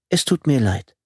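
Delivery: leicht höhere, bedauernde Frauenstimme; Frauenstimme Tine. A narrator's reading. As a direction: sanft entschuldigend, leicht höher. Tine entschuldigt sich. (leicht angedeutete Frauenstimme, bedauernd)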